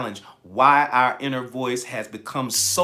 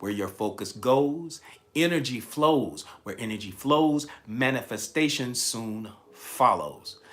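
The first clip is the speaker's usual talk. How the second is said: slow, each phrase laid down deliberately; slower repeat; 3 semitones lower, slower than usual. Shown as a demonstration. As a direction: spell it out slowly for the newbies. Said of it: "I'm gonna say that again, especially for my newbies".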